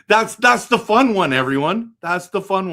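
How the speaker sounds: high, loud gleeful call to the chat; excited. Real, not acted. Excitedly telling chat his fun bracket pick. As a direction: burst out with delight at your pick.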